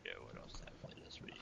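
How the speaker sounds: whispered muttering; whispering to himself. Real, not acted. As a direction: mutter the question under your breath. Muttering to himself, trying to recall.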